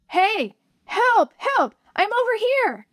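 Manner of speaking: high desperate shouts; shouting for help. A narrator's reading. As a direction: yell with all your strength. Calling for rescue.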